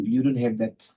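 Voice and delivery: ordinary talk, nothing marked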